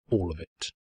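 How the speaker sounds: usual speaking voice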